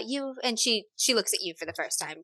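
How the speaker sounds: ordinary talk, nothing marked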